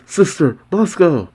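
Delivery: shouting. A narrator's reading. Happy to Sister and Moscow, shouting. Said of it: "Happy shouts".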